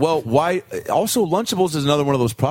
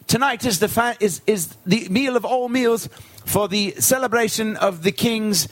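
higher, slow, formal herald voice; pompous higher-pitched steward; court servant; 7 semitones higher than usual. Acted. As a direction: announce the feast to the king. Servant voice announcing the feast.

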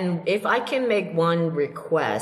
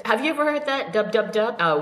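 ordinary talk

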